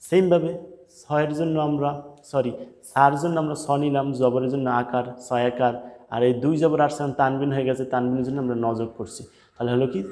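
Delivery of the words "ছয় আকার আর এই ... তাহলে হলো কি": normal talking voice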